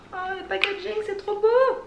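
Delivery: high, bright squeal of delight over the packaging; delighted gush. Real, not acted. Vlogger to viewers, thrilled by the packaging, high delighted squeal. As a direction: swoon over how pretty it is.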